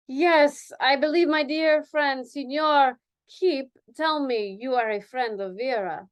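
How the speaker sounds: put-on Spanish accent. Dancer voiced with accent.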